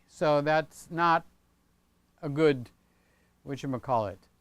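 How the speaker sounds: normal talking voice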